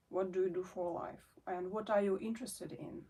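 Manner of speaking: usual speaking voice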